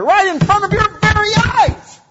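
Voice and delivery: high, loud preacher's exclamation; excited exclaim. Real, not acted. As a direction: burst out with wonder.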